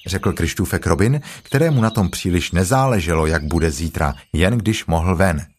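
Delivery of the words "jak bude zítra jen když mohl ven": ordinary delivery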